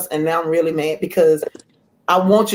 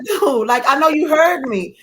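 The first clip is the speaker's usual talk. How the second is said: exasperated quote; 8 semitones higher than usual. Retold or imitated. Wife pressing husband, laughing.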